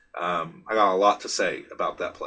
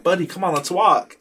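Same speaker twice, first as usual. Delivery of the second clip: put-on higher, coaxing tone to a toddler; 8 semitones higher, 5 dB louder than usual. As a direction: gently urge the kid to walk on. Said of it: "And I was like".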